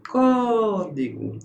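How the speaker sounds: Código slow, with "Có" held long; Código drawn out to show where the stress falls; stress on the antepenultimate. Shown as a demonstration. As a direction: stretch the opening syllable of Código. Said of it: "antepenúltima".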